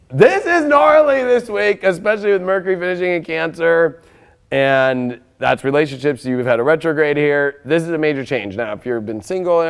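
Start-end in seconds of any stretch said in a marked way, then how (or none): 0.1-4.0 hyped up. Real, not acted. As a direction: stretch it out, really excited. Hyped reading of the card.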